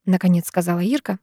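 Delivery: plain reading voice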